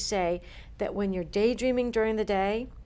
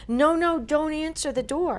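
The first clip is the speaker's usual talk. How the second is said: higher, urgent retold warning; raised-pitch retold "don't"; urgent plea; 6 semitones higher, 3 dB louder than usual. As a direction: imitate a client pleading to stop her husband. Urgent plea inside retold dream.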